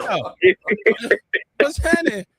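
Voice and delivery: high, startled what's happening; puzzled new father at the delivery; surprised yo. Retold or imitated. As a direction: blurt it out in shock, way up high. Startled new dad at birth.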